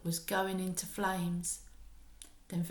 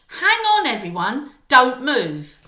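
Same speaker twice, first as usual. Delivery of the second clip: high, loud command to freeze; witch's cry; 4 semitones higher, 13 dB louder than usual. Acted. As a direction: halt the room, sharp and loud. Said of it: "cried the witch".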